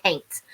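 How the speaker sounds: can't said as "caint", rhymes with paint; regional pronunciation. Shown as a demonstration. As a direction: say can't with a long AY vowel, "caint". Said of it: "everybody else didn't say can't".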